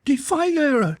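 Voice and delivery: very high, outraged screech; screaming creature. A narrator's reading. Creature accuses the armed intruder, screaming. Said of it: "it screamed".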